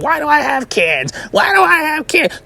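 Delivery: shrill-free but high put-on parent voice, rising as it repeats; mocking whiny parent. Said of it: "y'all question the kids, like".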